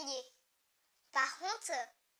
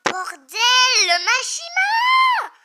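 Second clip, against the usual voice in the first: stretched, high shocked yell; real shock at the page, yelled out; drawn-out stunned cry; shocked shout; 9 semitones higher, 22 dB louder, much slower than usual. Real, not acted. Shocked reaction to manga page.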